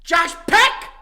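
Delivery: loud, high shouted impression; Josh Peck. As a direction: yell it high, in a put-on voice. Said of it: "One more time".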